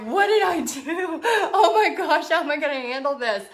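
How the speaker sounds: high-pitched retold panic through laughter; mock panic. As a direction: laugh while acting out an overwhelmed new mom. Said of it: "I was like".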